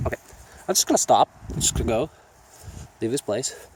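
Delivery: ordinary delivery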